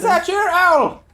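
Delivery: very high, shouted cry from aloft; crow's nest lookout. Acted. Fellow high in the crow's nest to those on deck, high-pitched cry out. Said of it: "there is a fellow in the crow's nest".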